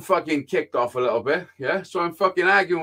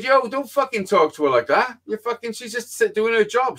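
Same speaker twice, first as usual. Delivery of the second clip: raised, high-pitched indignant protest; retold telling-off; 8 semitones higher than usual. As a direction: act out telling someone off. Retelling his telling-off of her brother.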